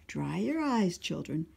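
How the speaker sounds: put-on animated children's story narration; storytime read-aloud. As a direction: read it out to a room of little kids. Reads picture book to children.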